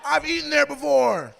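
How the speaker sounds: high, loud cheer; over-the-top excited yell; mock celebrating. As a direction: whoop it like a huge victory. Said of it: "why were you celebrating that".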